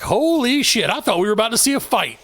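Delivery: high-pitched awed onlooker, imitated; mock astonished bystander reaction; retold amazement. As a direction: draw out the shock, pitched well up. Other coaches' amazed reaction, retold.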